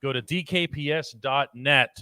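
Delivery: normal talking voice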